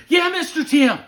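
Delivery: high, loud mock child's reply; eager little boy. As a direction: pipe up like an excited kid. Retold child eagerly answering guide.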